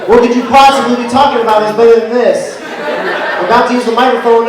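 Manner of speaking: mock scolding. Real, not acted. Comic scolding hecklers.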